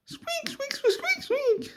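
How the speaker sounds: tiny falsetto mouse voice; mouse squeaks. Acted. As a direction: squeak very high like a little mouse. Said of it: "the mouse says".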